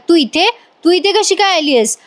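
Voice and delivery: sharp high angry demand; scolding. A narrator's reading. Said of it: "गौरीला ओरडायला". (रागाने ओरडून विचारणे)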